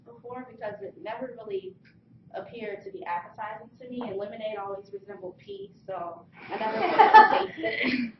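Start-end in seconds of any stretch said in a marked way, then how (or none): 5.4-8.1 laughing through the line, high and louder. Real, not acted. Telling the audience about lemons, laughing. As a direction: crack up as you say it.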